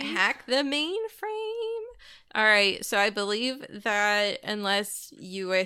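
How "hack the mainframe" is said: high, slow, hammed-up movie hacker voice; a playful, overblown echo of the running joke; mock dramatic hacker line. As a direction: draw the cliché out like a cheesy film.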